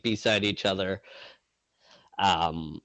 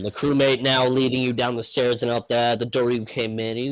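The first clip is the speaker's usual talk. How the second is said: ordinary talk, nothing marked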